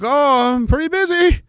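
high, strained imitation of a worn-out buddy; mock buddy voice. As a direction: squeak it out like an overworked soldier friend. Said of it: "He's like".

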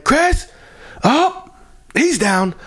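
high, urgent callout; alarmed call. Retold or imitated. Manager shouting to bartender that the drunk fell, high and urgent. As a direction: call it like a warning.

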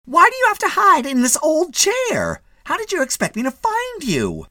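high, loud scolding wife; a shrill-voiced fussing wife, loud; nagging wife. A narrator's reading. Mrs Mitty scolds in hotel.

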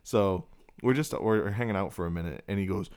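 ordinary talk

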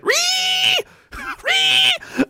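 very high shrill piggy squeal; mocking squeal. Mocking critics online, a high piggy squeal. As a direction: squeal like a pig to mock them. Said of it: "squealing like piggies".

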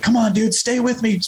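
high, pressing plea; urgent pleading. Retold or imitated. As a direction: reenact begging someone to hold on. Pleading with injured son while shaking him.

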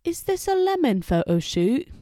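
put-on high, loud second try at the British accent; British accent again. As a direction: redo the Brit, rising on the question. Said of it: "Let me try again".

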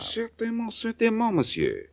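high, eager French certainement; eager French agreement. Acted. Frenchman eagerly agreeing to talk diamonds, French accent. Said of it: "Gonna try out your French accent?".

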